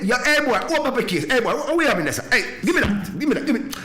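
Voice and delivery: hammed-up customs man ordering him to open the case; bossy customs officer. Acted Jamaican customs officer.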